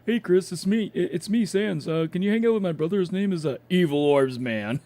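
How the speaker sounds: high, loud goofy skeleton voice; squeaky cartoon Sans invitation, fast; playful high cartoon character voice. Voicing Sans on stream.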